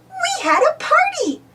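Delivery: high eager call; excited cry. A narrator's reading. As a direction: cry it out proudly. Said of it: "cries Millie".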